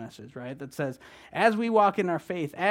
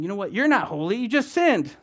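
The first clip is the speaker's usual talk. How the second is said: Satan accusing; 6 semitones higher, 8 dB louder than usual. Retold or imitated. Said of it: "Satan is saying".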